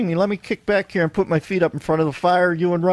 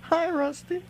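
higher squeaky dummy voice; 12 semitones higher, 6 dB quieter, slower than usual. Acted. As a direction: talk like a puppet. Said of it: "It sounds like it's you".

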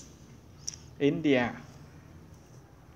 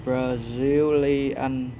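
Brazilian stretched out slowly and louder; slow drawn-out; 5 dB louder, much slower than usual. Real, not acted. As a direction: drag every syllable of Brazilian. Repeating the answer, drawn out.

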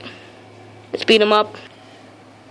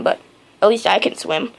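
ordinary delivery

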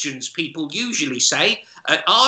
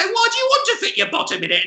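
high, quick, puzzled voice; high baffled shop assistant; much faster than usual. Retold or imitated. Assistant questions the customer, high and baffled. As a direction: ask it squeaky and bewildered.

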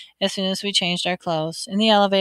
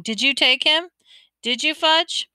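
higher, pressing questions; not so calm; 7 semitones higher, 4 dB louder than usual. A narrator's reading. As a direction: press a little brother, losing some calm. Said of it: "I asked not so calmly".

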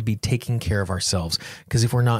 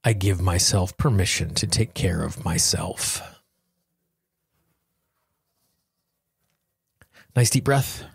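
guided tapping affirmations. Acted. Guiding listeners through a tapping session, slow and calm. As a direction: lead the listener gently through each phrase.

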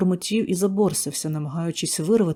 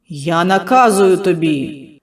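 loud, slow order; commanding shout; 9 dB louder, slower than usual. Acted. Healer commanding the demon, loud and slow. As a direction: stretch out наказую as a command.

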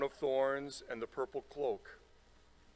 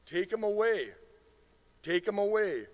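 high, loud crowd cry; 9 semitones higher, 6 dB louder than usual. A narrator's reading. Crowd demanding Pilate take the prisoner away, crying out. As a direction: call it out high and forceful, falling at the end. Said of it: "they cried out".

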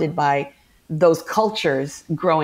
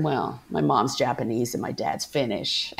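low, soft deadpan admission; sheepish confession; 6 semitones lower, 6 dB quieter than usual. Retold or imitated. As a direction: confess it quietly, dropping the pitch. Retold sheepish confession to actress.

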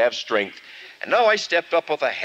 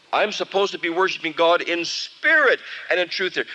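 ordinary delivery

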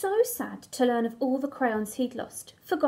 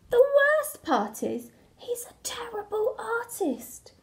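exasperated complaint; 7 semitones higher than usual. Acted. Crayon complains about the toddler's art.

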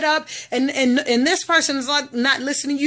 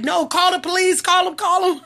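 retold urgent plea; 6 semitones higher than usual. Retells urgent plea to passers-by.

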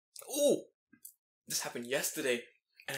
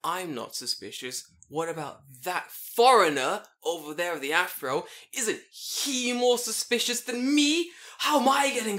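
slow, rising, louder protest; old man yelling his innocence at the cops; mock scream; 4 semitones higher, 5 dB louder, slower than usual. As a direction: ham up the old man's outraged protest. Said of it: "the guy screams in this station".

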